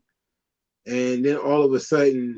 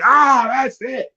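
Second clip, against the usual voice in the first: mock fan despair; 8 semitones higher than usual. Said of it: "everybody's like".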